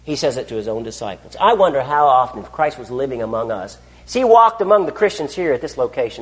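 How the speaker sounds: ordinary talk, nothing marked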